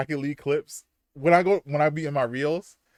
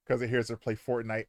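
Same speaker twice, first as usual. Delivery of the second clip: ordinary delivery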